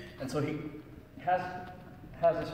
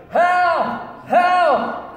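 loud, high desperate shout; shouting for help; 16 dB louder than usual. Acted. Man clinging to a cliff, shouting loud and high for help. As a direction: yell it like a man hanging from a cliff. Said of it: "he's shouting".

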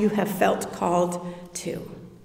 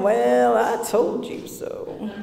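smug told-you-so; 3 dB quieter, slower than usual. Retold or imitated. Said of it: "Jesus is sitting back going".

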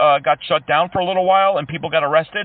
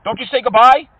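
louder, higher indignant complaint; mock outrage; 6 semitones higher, 5 dB louder than usual. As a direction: play it hurt and offended. Caller feigning outrage at hang-up.

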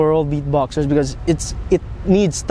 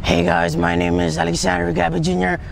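intro line growled from the throat like a robot; beatboxer's robot throat voice; throat bass voice. Shown as a demonstration. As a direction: push the words through a buzzing throat bass. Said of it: "it sounds like this".

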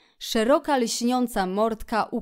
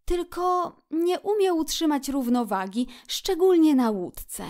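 high otter voice; Otter, musing; 7 semitones higher than usual. A narrator's reading. Otter comments on Toad's poor balance, musing. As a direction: add a reservation about a friend.

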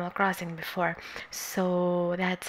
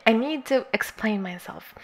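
whispered. Real, not acted. Repeats the line in a whisper.

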